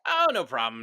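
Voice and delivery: loud, breezy no-worries; brushing off the danger with a shout; casual guide. Retold or imitated. Boat guide shrugging off gators, loud and breezy. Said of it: "and he was like".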